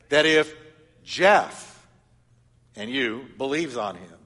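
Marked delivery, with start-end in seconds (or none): 0.1-1.9 preacher spotlighting his own name in the verse; stress on the name. Shown as a demonstration. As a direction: hit "Jeff" hard, set apart by a pause. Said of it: "Do your name".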